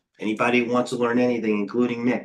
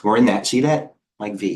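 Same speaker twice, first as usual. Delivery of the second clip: usual speaking voice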